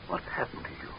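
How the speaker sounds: high, breathless whispered fear; frightened whisper. Acted. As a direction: whisper it in dread. Leader frightened by the doctor.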